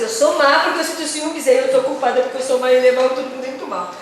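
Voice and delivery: mock self-blame. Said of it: "você fica assim".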